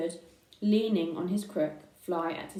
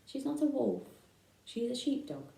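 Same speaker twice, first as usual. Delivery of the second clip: higher then dropping softer, wounded; hurt voice; 4 semitones higher, 4 dB quieter than usual. Acted. Babe corrects Ma about his mother, in a hurt voice. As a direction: defend his foster mother, quietly hurt. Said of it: "in a rather hurt voice".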